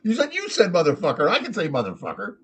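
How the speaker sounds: high parrot voice, indignant; arguing parrot. Acted. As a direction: argue back as a foul-mouthed parrot. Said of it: "The parrot's like".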